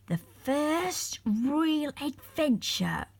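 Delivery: slow, savoured delight. A narrator's reading. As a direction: marvel at the first real adventure. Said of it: "said Molly in delight".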